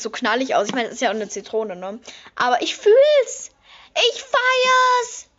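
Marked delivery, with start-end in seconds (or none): 2.4-5.3 high and loud, first words stretched slow; excited squeal. Real, not acted. Showing viewers the lemon palette, a high delighted squeal.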